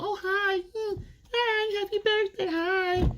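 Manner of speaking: breathy falsetto sweet greeting; sugary high flirting.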